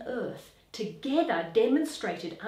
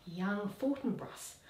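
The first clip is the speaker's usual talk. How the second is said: usual speaking voice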